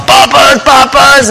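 high, drawn-out hawker's call; mimicked high-pitched vendor shout; imitated street vendor's yell. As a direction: call it out like a passing street seller. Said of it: "walk by and yell".

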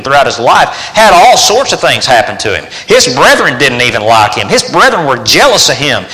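full-force pulpit preaching; fervent preaching. Acted. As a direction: hammer the point home to the congregation.

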